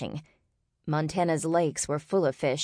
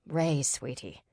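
soft, tender father's voice; quiet, patient endearment; gentle father; 2 semitones lower, 5 dB quieter, slower than usual. A narrator's reading. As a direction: soothe a daughter gently. Father gently soothes his daughter.

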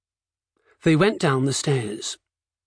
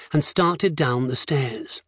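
ordinary delivery, nothing marked

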